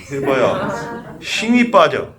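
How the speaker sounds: ordinary talk, nothing marked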